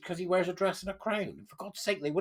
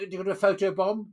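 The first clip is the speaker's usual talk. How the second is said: normal talking voice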